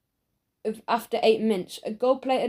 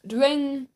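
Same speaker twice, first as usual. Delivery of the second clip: drawn-out, higher "dring"; imitated bell ring; 4 semitones higher than usual. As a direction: mimic a bell with your voice.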